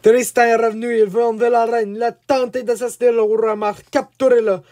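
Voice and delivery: high, loud alarm cries; shouted commands. A narrator's reading. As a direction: call out orders across a castle. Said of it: "suivis de commandements hurlés".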